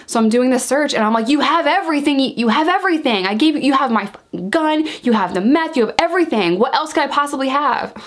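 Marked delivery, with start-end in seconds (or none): 1.3-7.9 higher-pitched, exasperated mock yelling; mock outraged rant; retold screaming. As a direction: act out screaming at an officer, pitched up but not full volume. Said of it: "that's what I was screaming at her".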